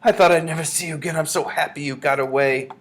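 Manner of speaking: high, loud, emotional grandmother; relieved cry. A narrator's reading. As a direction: gush with relief at a reunion. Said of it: "she cried".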